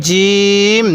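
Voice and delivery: hard, firm j in জিম, vowel held long; firm pronunciation. Shown as a demonstration. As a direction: press the j of জিম, then sustain it evenly. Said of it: "জিমটা আমরা শক্ত করে উচ্চারণ করব".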